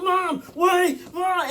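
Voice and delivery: high-pitched cries for mom; acted frantic shout while fleeing; panicked calling out. Acts out running to mother.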